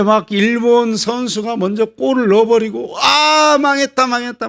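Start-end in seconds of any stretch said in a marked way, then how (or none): 3.0-4.5 high, wailing mock dismay; hammed-up doom; panicked despair. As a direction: cry out like a fan watching it all collapse. Acted fans despairing at goal conceded.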